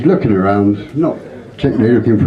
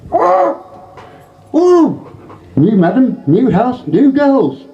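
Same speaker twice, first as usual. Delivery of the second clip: squawky parrot; slower than usual. Acted. Parrot greeting new owner in high squawk. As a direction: squeal it out like a brothel parrot.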